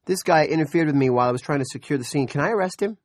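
fast, high, loud eager appeal. A narrator's reading. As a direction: eagerly ask the chief. Deputy eagerly asking to arrest.

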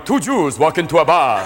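[name] joke. Retold or imitated. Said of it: "[name]'s at the Improv going".

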